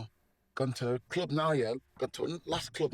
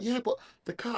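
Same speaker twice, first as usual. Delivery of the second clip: high-pitched flustered protest; raised, anxious re-enactment; retold panicking; 9 semitones higher than usual. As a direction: butt in with mock panic. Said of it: "I'm just panicking".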